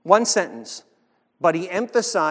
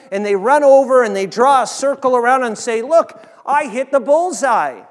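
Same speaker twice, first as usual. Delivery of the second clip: fiery preaching; 5 semitones higher, 7 dB louder than usual. Real, not acted. Preaching at full force.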